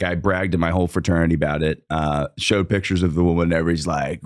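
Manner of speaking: normal talking voice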